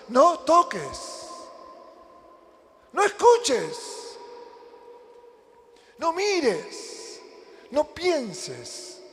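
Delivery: each short command drawn out and separated by long pauses; slow, high, insistent preacher's orders; emphatic spelled-out commands. Acted.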